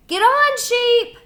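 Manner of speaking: high, loud call; shouting. A narrator's reading. Animals shouting at Sheep, high and loud. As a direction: yell for her to climb on. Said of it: "everyone shouted".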